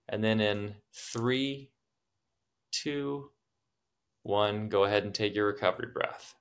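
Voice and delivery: slow, soft countdown. Shown as a demonstration. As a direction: count them down gently. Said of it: "And maybe go a couple more".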